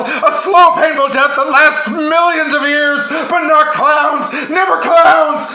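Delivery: high, loud, slow horrified wail; hammed-up shouted panic; terrified pleading. A narrator's reading. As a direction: beg like clowns are the worst fate. Fred panicking about clowns.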